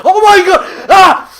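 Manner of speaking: screams in sudden fright; jump-scared shriek; terrified scream. Real, not acted. Jumped by monster.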